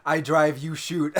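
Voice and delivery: playful action-scene quote; acted movie line. Acting out imagined movie scene.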